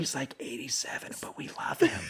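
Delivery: soft whisper, fond and sly; a pretend nurse whispering about old Wesley; gossipy whisper. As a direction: murmur it like a nurse with a crush.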